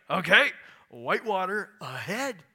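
thrill-seeker ready for rapids; a touch of mock excitement; eager. Eager canoer voiced, excited.

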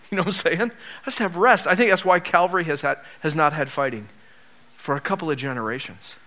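usual speaking voice

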